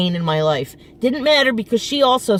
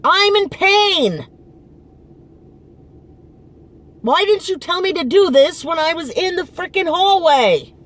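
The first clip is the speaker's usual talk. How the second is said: yelling at mother; 9 semitones higher, 4 dB louder than usual. Retold or imitated. Daughter re-enacting yelling at her mother over her knee, loud. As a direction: shout it out in pain and frustration. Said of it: "So I yelled at her".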